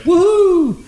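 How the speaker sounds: high-pitched re-enacted whoop; retold cheer. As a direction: whoop it as in the garage. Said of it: "And I was like".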